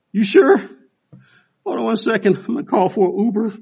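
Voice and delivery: out of breath. Retold or imitated. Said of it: "I'm huffing and puffing".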